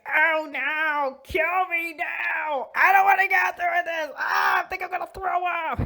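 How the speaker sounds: high, hammed-up wail of disgust; T'Challa, mock agony. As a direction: moan as if being tortured. T'Challa appalled by the kiss.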